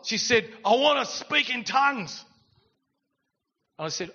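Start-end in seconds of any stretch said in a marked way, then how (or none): 0.6-2.2 high, loud, drawn-out declaration; retold fired-up outburst; pumped, imitated. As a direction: shout it slowly as her eager demand. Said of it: "she was pumped".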